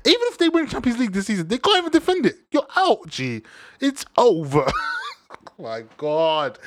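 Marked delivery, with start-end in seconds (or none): 0.0-4.6 mock panic, pitch climbing high and fast; imitating a City player. Vlogger voicing City players' despair, mock panic. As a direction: lightly voice a gutted City player. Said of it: "They're thinking".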